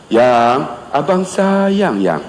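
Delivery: slow, drawn-out loving plea; tender sweet talk. Acted. Husband without a gift sweet-talking his wife, tender and slow. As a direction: coo it slowly, lingering on each endearment.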